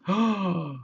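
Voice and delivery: sharp shocked gasp; the gang sees who is under the wig. Acted. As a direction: gasp in surprise. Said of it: "the gang gasped".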